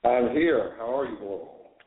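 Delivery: echoey speakerphone in small room; talking on speaker, roomy echo. Real, not acted. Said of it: "Sounds like you got an echo".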